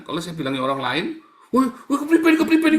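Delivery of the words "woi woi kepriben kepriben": very high, loud panicked yelling; hammed-up frantic cry for help; mock panic. Swimmer with cramp calling strangers, comic panic.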